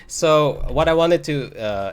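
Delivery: ordinary talk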